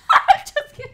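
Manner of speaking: high, loud cackling retraction; laughing. Real, not acted. Friend taking back a teasing joke, laughing loudly. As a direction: squeal it through laughter.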